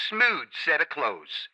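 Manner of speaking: ordinary talk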